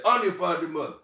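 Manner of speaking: dad's stern retort. Retold or imitated. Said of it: "my dad said".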